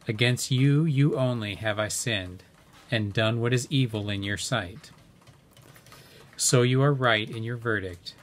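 ordinary talk, nothing marked

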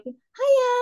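very high, bright sing-song Hiya; northern English shop greeting; British greeting imitated. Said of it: "people there is just like".

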